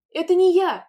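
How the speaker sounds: high, loud panicked cry; a child denies it; shout. A narrator's reading. Taiska to mother, panicked shout. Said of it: "крикнула Таиска".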